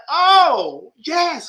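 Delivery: loud, high acted delight; hammed-up excited realization; mock eager agreement. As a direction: play a thrilled convert, voice leaping up. Voicing a supporter hearing the slogan.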